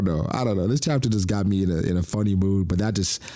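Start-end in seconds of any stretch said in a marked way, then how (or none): none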